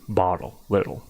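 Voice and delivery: bottle and little softly with a D-like tap; soft D whisper. Shown as a demonstration. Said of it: "maybe it sounds like a D sound".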